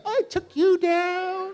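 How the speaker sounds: very high, slow, loud imitation; exaggerated motherly boast; crowing elderly mom.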